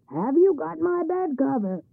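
high, loud demanding call; troll calling. A narrator's reading. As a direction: shout the question across to the farm. Troll calls across demanding.

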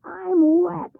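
loud, high cry of alarm; shouted. A narrator's reading. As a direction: shout it, suddenly soaked. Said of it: "he shouted".

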